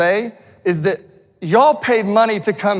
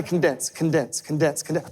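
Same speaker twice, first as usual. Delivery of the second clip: fading repetition; 4 semitones lower, 6 dB quieter than usual. Real, not acted. Repeated word fading out.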